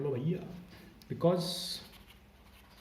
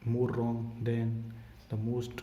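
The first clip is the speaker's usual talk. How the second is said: slow dictation; much slower than usual. Shown as a demonstration. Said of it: "teacher dictating slowly".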